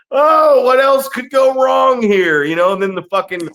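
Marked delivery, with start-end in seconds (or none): 0.1-2.4 high, loud and slow, hammed-up exasperation; exasperated mock shout. Wrestler reacting to the chaos in the ring, with a high, slow, hammed-up shout. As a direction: wail it like everything is falling apart. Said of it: "And I'm just like".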